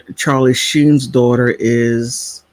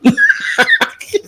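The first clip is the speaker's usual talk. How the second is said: high, quick cackle; bursting laughter; 10 semitones higher than usual. Real, not acted. Daughter joking about original liberal mom, cackling.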